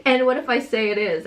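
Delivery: plain reading voice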